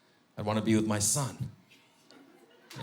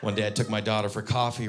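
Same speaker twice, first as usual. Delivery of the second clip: normal talking voice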